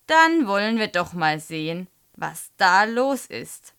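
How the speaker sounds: loud, deliberate teacher's announcement; stern. A narrator's reading. Teacher about to search pupil's satchel, stern. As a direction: announce it firmly, stretching the end.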